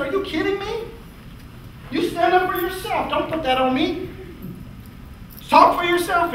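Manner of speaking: high, incredulous pushback; heated retort. Retold or imitated. Speaker retelling how he snapped back at his colleague, high and incredulous. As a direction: throw it back at her, stunned.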